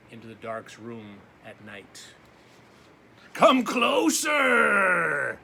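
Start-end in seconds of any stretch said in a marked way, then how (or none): 3.3-5.4 slow, loud drawn-out booming dark. Acted. The dark beckons the boy closer, slow and booming. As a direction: stretch it out, huge and looming. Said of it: "said the dark".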